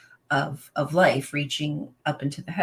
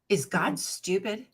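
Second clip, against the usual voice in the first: usual speaking voice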